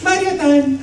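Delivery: very high, playful cartoon plumber voice; Mario. Voice actor teasing his audience with the high Mario catchphrase voice. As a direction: pipe it up high as the game hero. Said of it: "Since you've been doing Mario".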